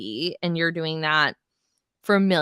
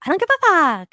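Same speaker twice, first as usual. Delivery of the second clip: much higher, much louder yelled quote; imitated yell; 12 semitones higher, 8 dB louder, faster than usual. Host imitating Louis yelling at Teresa, loud mock yell. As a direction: blast it like an enraged man. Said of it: "Him yelling".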